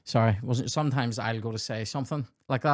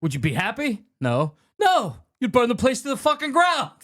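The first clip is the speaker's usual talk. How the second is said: acted outrage; 8 semitones higher than usual. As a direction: burst out high on the last lines. Acts out angry film monologue.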